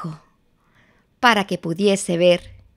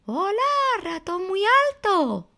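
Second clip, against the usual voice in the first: ratón muy bajo; 12 semitones higher, 3 dB quieter than usual. Acted. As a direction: greet a friend in a high story-mouse voice. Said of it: "Y ratón muy bajo decía". (saludo agudo y cantarín de ratoncito)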